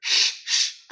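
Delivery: usual speaking voice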